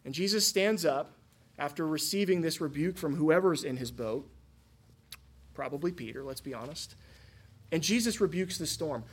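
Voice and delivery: ordinary talk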